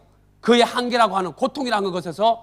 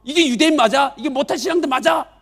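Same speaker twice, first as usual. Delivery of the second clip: higher, louder accusing sneer; accusing jeer; 5 semitones higher, 5 dB louder than usual. Acted. Sick man pointing at others, louder accusing jeer. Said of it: "하면서 다른 사람을 향해 손가락질".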